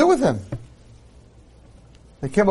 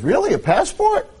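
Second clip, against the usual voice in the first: surprised angel; 11 semitones higher than usual. Acted.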